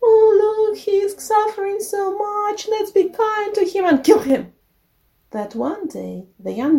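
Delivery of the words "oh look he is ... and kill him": very high fake-sweet pleading. A narrator's reading. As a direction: witch wheedling in a sugary, put-on high voice. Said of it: "the wicked witch continued to say".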